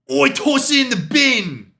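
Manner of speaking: high, loud hammed-up British accent; mock a Brit with a cartoonish British accent; bad British accent. Streamer mocking British slang for chat, in a horrible British accent. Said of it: "That was a horrible accent".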